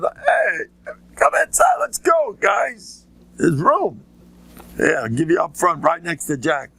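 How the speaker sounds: high welcoming call; calling people in. Retold or imitated. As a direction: beckon guests to the front. Reb Nosson calls guests in.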